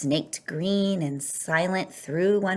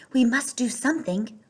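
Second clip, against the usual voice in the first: plain reading voice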